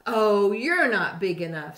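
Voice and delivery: loud, scornful brush-off; grouchy dismissive. A narrator's reading. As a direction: wave them off loudly. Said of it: "said the grouchy ladybug".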